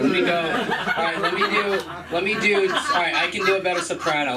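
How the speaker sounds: ordinary talk, nothing marked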